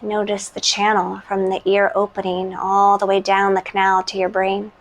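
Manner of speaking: calm, unhurried meditation voice with long pauses; guided meditation. Real, not acted.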